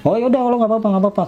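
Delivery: high-pitched acted reassurance; insistent reassurance. Acted benefactor brushing off the price.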